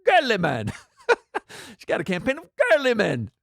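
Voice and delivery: a light mock Scottish accent on Girly men; half-hearted Scottish accent. Shown as a demonstration. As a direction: toss off Girly men in a hint of Scottish brogue. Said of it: "can I do that in Scottish accent".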